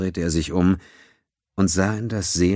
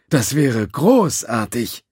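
slow, higher insincere politeness; 7 semitones higher, 4 dB louder, slower than usual. A narrator's reading. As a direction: say it with a fake smile. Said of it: "Seinem Lächeln fehlte jede Aufrichtigkeit".

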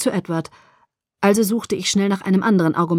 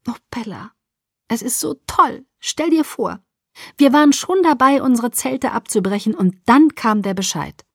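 breathy start, then higher-pitched gushing; excited mother; 4 semitones higher than usual. Acted. Mother telling daughter about the news, excited. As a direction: gush the good news.

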